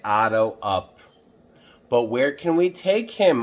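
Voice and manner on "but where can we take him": higher, louder anxious ask; boy's worried question. Acted. Boy asking the firemen, worried and high. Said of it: "I asked".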